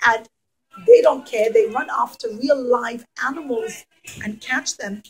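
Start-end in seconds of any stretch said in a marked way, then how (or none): none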